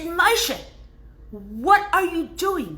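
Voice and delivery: high-pitched wife, fed up and slow; nagging wife woken by pacing; exasperated wife. Retold or imitated. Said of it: "She said".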